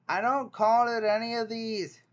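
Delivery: high, loud exasperation; exasperated outburst. Real, not acted. Rejecting all the quiz answers, loud and high.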